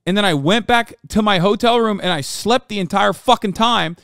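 exasperated shouting at chat; real anger. Real, not acted. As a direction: yell it out, truly fed up. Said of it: "And I'm mad".

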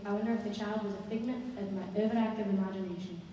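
ordinary delivery, nothing marked

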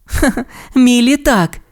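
high "ha-ha" before the answer; light acted laugh. A narrator's reading. Ace explains Amelia to Ryder, lightly acted laugh. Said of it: "засміялася Ейс".

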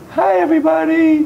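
high, loud doll greeting; mommy doll voice. Pretend. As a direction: let the mommy doll wave hello.

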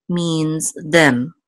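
ordinary talk

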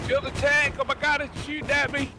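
high, loud alarmed yell; panicked yelling. Real, not acted. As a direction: shout for help under fire. Under fire, yelling.

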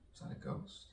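spooked whisper. Pretend. Spooked by a ghost.

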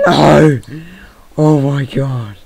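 full-volume fright while being chased; terrified scream. Real, not acted. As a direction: scream as the monsters close in. Attacked by mobs at night.

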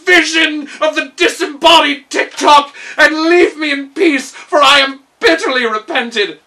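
loud, high frightened begging; terrified pleading. A narrator's reading. Gnome king begging the phantom to leave, panicked.

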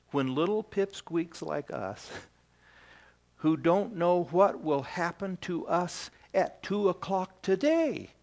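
ordinary talk, nothing marked

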